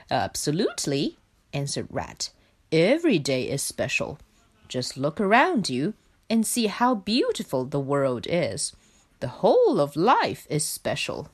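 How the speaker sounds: a contented rat explaining; Rat's voice. Acted.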